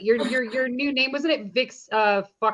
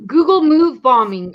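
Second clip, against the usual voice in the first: loud; 5 semitones higher, 8 dB louder, slower than usual. Real, not acted. Reading chat aloud loudly.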